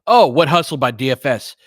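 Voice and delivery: ordinary talk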